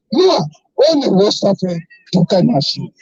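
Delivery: normal talking voice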